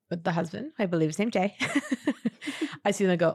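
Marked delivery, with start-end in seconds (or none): 1.6-2.8 a high, breathy giggle; short high giggle. Real, not acted. Laughs at her own story.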